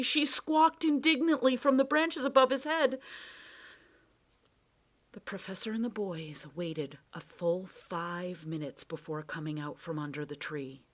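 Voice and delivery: ordinary delivery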